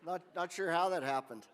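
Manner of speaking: normal talking voice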